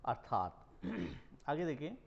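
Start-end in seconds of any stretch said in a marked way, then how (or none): 0.1-0.7 अर्थात said lower, as "ar-thaat"; correct pronunciation. Shown as a demonstration. Teacher models how it should be spoken, correct pronunciation. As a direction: put the r before "thaat" in अर्थात, pitched down. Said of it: "जबकि बोलना चाहिए".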